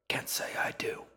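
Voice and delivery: dismissive breathy whisper; old woman whispering. A narrator's reading. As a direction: whisper it without looking up. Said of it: "the woman whispered".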